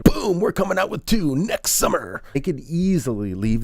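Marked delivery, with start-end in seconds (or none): 0.0-2.2 higher, hammed-up studio-teaser voice; mock hype announcement. Mocking a heavy-handed sequel tease, hammed-up announcer. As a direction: sell the sequel like a pushy trailer. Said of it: "hammer you over the head".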